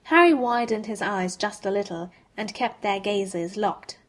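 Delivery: ordinary delivery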